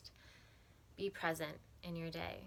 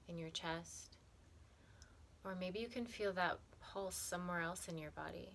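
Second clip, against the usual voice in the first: calm, softened meditation voice with long pauses; unhurried, even, soothing meditation guide; guided meditation; 6 dB quieter than usual. Acted. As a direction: guide them gently through breath and body, leaving space between phrases.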